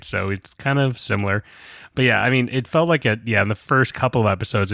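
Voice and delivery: ordinary talk, nothing marked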